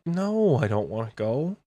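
ordinary delivery

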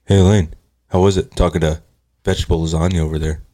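low, deadpan sitcom boyfriend impression; Elaine's boyfriend. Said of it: "you can do Elaine's boyfriend".

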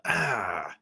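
usual speaking voice

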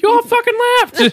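very high, loud protest; hammed-up indignant squawk; mock outrage. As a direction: yell it like an abandoned friend. Retold outraged text from friend.

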